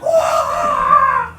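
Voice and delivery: very high, loud whoa; a gasp from the whole square; awed crowd. Acted. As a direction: crowd shouting in awe. Crowd amazed by throw.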